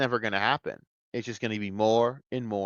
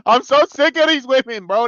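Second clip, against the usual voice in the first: higher and louder, fed up; exasperated outburst; 12 semitones higher, 8 dB louder than usual. Real, not acted. Fed-up viewer bursts out louder about the female characters. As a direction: blow up in frustration.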